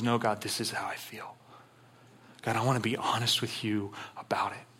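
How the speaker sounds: whispered, intimate confession to God; hushed honest prayer. Acted. David whispering his honest feelings to God. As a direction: breathe it out like a private prayer.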